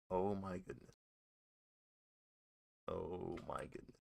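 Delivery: soft, hushed disbelief; stunned murmur. Real, not acted. Streamer just heard a possible drive-by, stunned and hushed. Stunned after hearing gunshots.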